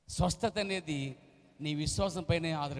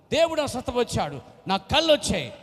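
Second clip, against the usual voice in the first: higher, louder calling out like a paper seller; 6 semitones higher, 9 dB louder than usual. Retold or imitated. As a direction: announce it to passers-by with joy. Blind man calling while selling papers. (Paper అమ్ముతూ గట్టిగా ప్రకటన)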